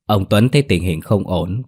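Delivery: ordinary delivery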